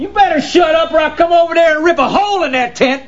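shouted threat. Acted. Man threatens the yelling neighbour, shouting back. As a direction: shout it back across the campsite.

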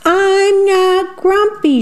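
high, drawn-out protest without much volume; indignant storybook shout. A narrator's reading. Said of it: "shouted Jim as he beat his chest".

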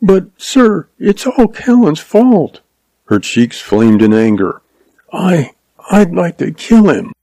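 put-on high, earnest young schoolteacher, a touch of anger; lightly voiced girl blaming the outlaw; young woman's voice. A narrator's reading.

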